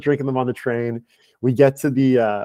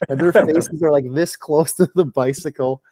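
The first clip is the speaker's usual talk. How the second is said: ordinary delivery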